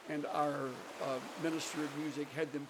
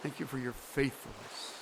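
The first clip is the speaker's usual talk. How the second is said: prayer; 6 dB quieter than usual. Acted. Preacher praying for the congregation, soft hushed prayer. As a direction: pray gently to close.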